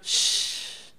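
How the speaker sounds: long unvoiced shh of release; relaxed exhale hush. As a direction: breathe out all the tension. Shh, se baja usted.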